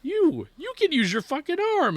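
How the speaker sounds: squeaky mock-indignant dad; mock outrage. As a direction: catch the kid out in disbelief. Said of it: "I go".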